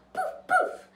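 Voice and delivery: very high, loud poof poof; magic poof. Acted. Godmother casting a spell, squeaky poof. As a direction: pop the magic words.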